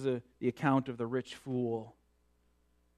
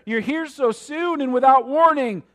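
higher, louder desperate cry; despairing cry; 13 semitones higher, 13 dB louder than usual. A narrator's reading. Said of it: "he cried out in despair".

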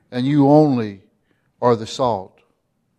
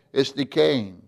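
usual speaking voice

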